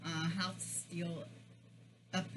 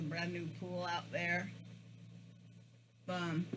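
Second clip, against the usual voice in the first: talking between bites; words muffled by food; mouth full, chewing; slower than usual. Real, not acted. Seen 2: Bites fried ball about 3.6s, cheeks full chewing afterward.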